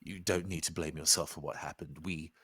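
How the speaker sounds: soft, low, tender reassurance; gentle comfort. Acted. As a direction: speak softly to someone hurt. Character comforts a returned friend.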